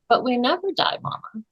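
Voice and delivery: put-on high, soft little girl voice; a hint of a knowing child; child's voice. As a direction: lightly play the wise little daughter. Child voiced in retold story.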